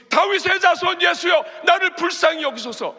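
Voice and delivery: very high, full-force shout; loud screamed cry for mercy; screaming plea. Acted. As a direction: yell out as the desperate blind man. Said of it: "막 소리를 지르는 거예요".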